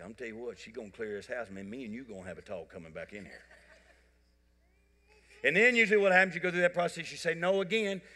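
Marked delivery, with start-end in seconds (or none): 0.0-3.4 muttered low threat to wife; father warning his wife quietly in front of the child; low aside to wife. Retold or imitated. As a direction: say it low and under your breath.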